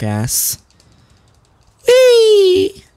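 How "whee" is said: very high gleeful squeal; gleeful whee. Real, not acted. Joyful squeal in game.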